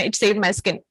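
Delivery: usual speaking voice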